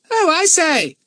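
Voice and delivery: high, loud squawking parrot; parrot cry. A narrator's reading. The parrot Kiki crying out to warn the children, high squawk. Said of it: "cried Kiki".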